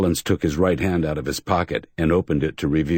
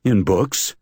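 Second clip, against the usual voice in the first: mildly hurt, defensive; a touch of injured pride; 4 semitones higher, 3 dB louder than usual. Acted. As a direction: say it a little wounded. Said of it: "said the man in an injured tone".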